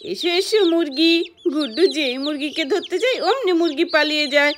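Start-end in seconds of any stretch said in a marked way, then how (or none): none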